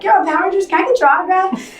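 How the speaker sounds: high, gushing fan voice; excited fan. Retold or imitated. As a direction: gush like a starstruck fan. Imitating excited casting fans.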